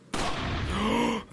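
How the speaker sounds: loud high gasp of shock; startled loud gasp; sharp gasp. Real, not acted. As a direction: gasp out loud, caught off guard. Sudden shock in game.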